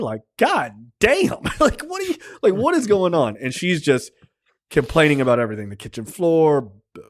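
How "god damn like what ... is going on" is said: stunned disbelief. Retold or imitated. Reacting to monologue.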